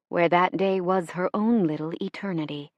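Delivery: plain reading voice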